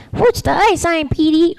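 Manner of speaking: put-on high, light little-boy voice; clean kid voice. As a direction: sound like a sweet little boy. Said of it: "the little boy sounds like".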